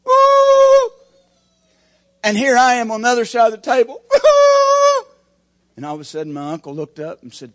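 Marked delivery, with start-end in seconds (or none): 0.1-0.9 high, loud woo; cheering whoop. Retold or imitated. Inmates whooping as uncle is saved, loud whoop. 2.2-4.0 excited telling. Retold or imitated. Excited retelling of prison scene. 4.1-5.0 joyful laughing cry. Real, not acted. Crying and shouting joy.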